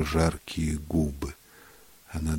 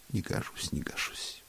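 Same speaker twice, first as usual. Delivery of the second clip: near-whispered, fading repetition; hushed refusal; 4 semitones higher, 7 dB quieter than usual. A narrator's reading. Woman declining talk of marriage, hushed and near-whispered. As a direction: trail off into a hushed echo.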